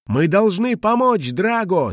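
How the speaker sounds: high, urgent slow shout. Acted. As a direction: call out urgently to help. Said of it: "закричала Мона".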